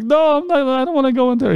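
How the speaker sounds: high-pitched, panicky protest; acted frightened objection; scared refusal.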